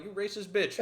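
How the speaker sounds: high, loud, laughing through the words; mock outrage through laughter.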